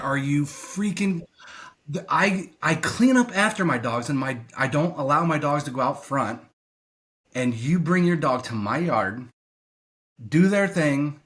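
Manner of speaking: imagined telling-off, punching up key words; indignant retold rant. Venting about dog walkers using his lawn, indignant.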